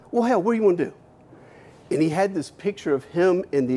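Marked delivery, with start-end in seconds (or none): 0.1-0.9 country boss. Retold or imitated.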